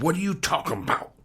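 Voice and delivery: high-pitched country-storyteller disbelief; mock bewilderment. Answering the lady who came up behind him, mock bewildered. Startled reply to stranger in story.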